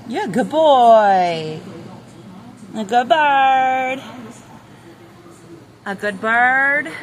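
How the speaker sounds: slow, high cooing praise; pet talk. Real, not acted. Owner cooing slowly at the rooster. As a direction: draw it out like praising a dog.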